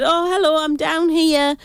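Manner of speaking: retold shout. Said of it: "she just shouted".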